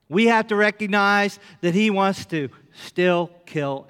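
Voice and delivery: loud, slow preacher's proclamation; preaching. Acted. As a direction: preach it to wake the church. Preaching at full force.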